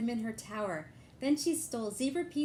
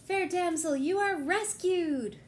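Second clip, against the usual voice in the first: heroic proclamation; 7 semitones higher, 6 dB louder than usual. Acted. Knight announcing the rescue to the damsel, loud and grand. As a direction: declare it like a proud hero.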